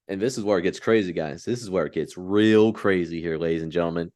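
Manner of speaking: low, dramatic announcer voice; dramatic hype voice. Acted. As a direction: drop into a big movie-trailer tone. Hyping up the crazy stretch.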